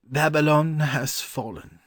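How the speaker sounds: high, regretful scholar's voice; sighing. A narrator's reading. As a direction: lament it with a sigh. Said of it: "he answered with a sigh".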